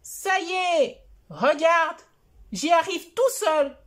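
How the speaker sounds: put-on high, whiny little boy voice; child voice. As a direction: plead like a small child. Voix de Choupi. (voix aiguë de petit garçon qui pleurniche)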